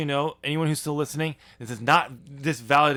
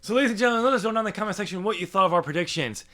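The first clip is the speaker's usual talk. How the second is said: host outro; 6 semitones higher, 3 dB louder, faster than usual. Acted. Outro host address to viewers.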